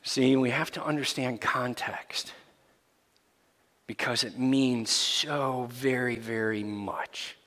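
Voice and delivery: hushed, breathy whisper to the congregation. Real, not acted. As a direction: lean in and whisper the point. Hushed aside after shock.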